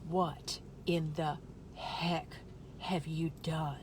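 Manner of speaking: a disappointed belly lecturing her slowly; scolding belly voice. Pretend. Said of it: "That's what my belly says".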